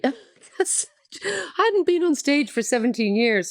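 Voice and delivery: slow, tearful laugh through the words; laughing while holding back real tears; teary. Real, not acted. Guest tearing up on a podcast, slow and choked. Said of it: "I've got a bit, bit teary".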